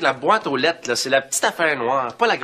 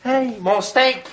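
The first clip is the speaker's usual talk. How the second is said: indignant outcry; 8 semitones higher, much slower than usual. Acted. Discovers babysitter ate his steak.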